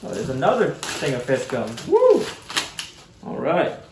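usual speaking voice

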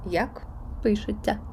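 usual speaking voice

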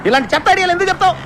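high-pitched angry outburst; angry yelling. Acted. Scolding a friend for a rubbish idea, angry yelling. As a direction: snap in exasperation, pitch shooting up. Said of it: "అరుస్తావేంటి Appalaraju?".

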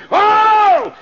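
high, loud, long oh; sudden wail of dread; preacher's outcry. Real, not acted. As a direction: burst out with a pulpit cry. Preacher cries out.

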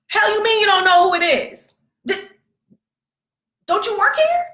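high, loud incredulous demand; outraged demand. Retold or imitated. Outraged mom confronting camp staff.

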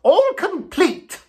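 boastful toad showing off his stores; triumphant Toad. A narrator's reading. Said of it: "said the toad triumphantly".

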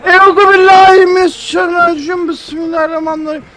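loud, hammed-up prayer; mock prayer. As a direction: pray loudly for luck, half joking. Mock prayer before running.

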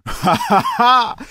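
a sneering, high "ha ha ha" at the captive; mocking villain laugh. Gangster gloating at Tintin, mocking laugh. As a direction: gloat over the trapped boy with a fake laugh.

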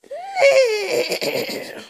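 high, loud mock neigh; whinny like a horse; squealing pretend horse noise.

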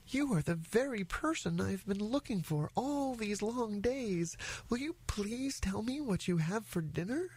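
high little elephant voice; eager child. Acted. Elephant's child begs the crocodile to tell, high and eager. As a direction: plead eagerly in a small high voice. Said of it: "grew all breathless and panted".

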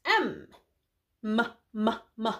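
repeated M said as a hummed mmm; m sound demo. Shown as a demonstration. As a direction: press lips and hum M, m, m. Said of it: "You make the sound at home too".

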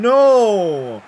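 anguished cry. Real, not acted. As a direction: cry out in real despair. Faces extra time after late goal.

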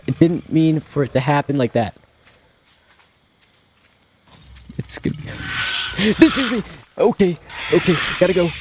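real panic mixed with play; panicked pleading. Real, not acted. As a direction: beg for your life, half joking. Begging dinosaurs not to kill him.

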